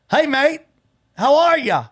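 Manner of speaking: lightly mimicking the Australian husband's friendly greeting; bad Australian accent. Retelling the husband's phone call, in a weak Australian accent. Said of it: "Even as bad as you are at accents".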